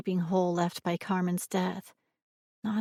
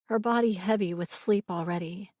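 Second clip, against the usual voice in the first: ordinary delivery, nothing marked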